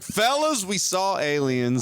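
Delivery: high, slow awed announcement; a hammed-up gasp of wonder; mock amazement.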